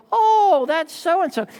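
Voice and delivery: high, loud "oh" of sudden recognition; mock delighted discovery; light act of spotting an old friend; surprised recognition.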